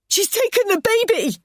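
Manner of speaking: high, loud anguished cry. Acted. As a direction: wail it over and over. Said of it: "I cried again and again".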